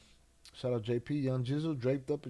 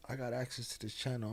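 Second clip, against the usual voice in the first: ordinary talk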